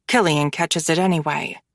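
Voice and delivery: ordinary delivery, nothing marked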